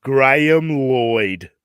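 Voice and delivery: broad Australian accent, slow and loud; thick Australian accent. Shown as a demonstration. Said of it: "say it with your thick Australian accent".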